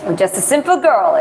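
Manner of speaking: amateur Texan impersonation with swooping pitch; Texan accent. Shown as a demonstration. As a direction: put on an exaggerated Texan accent. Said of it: "it might be a horrible impersonation".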